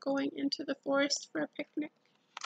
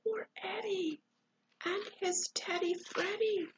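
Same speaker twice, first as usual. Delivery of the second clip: softer, higher sympathetic coo; pitying; 6 semitones higher, 3 dB quieter than usual. Real, not acted. Reader pitying Eddie in hiding, pitying. Reader pities frightened boy.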